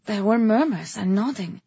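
plain reading voice

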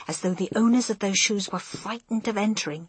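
usual speaking voice

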